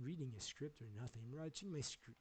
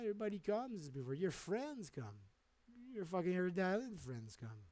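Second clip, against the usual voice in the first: ordinary delivery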